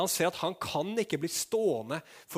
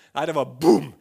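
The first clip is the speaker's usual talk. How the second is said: loud boom; 14 dB louder than usual. Acted. Contrast to casual greeting.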